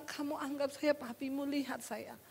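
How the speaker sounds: hushed, coaxing quote; gentle husband, retold. Husband asks wife to picture her father, softly coaxing. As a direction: re-enact a husband's soft plea.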